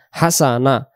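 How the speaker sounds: normal talking voice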